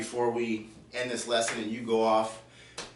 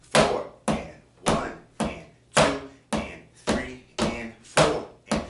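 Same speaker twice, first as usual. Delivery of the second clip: slow, loud count; teacher showing an even slower practice count; counting very slowly; 4 dB louder, slower than usual. Shown as a demonstration. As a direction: drag out every beat for practice. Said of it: "maybe even slower".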